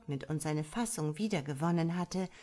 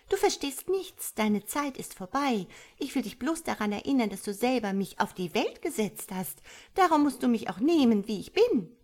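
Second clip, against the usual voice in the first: put-on high young voice, louder at the end; defiant tadpole; 6 semitones higher, 5 dB louder than usual. A narrator's reading. Tadpole tells mother to accept it, defiant. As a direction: stand up to your elder, quickening.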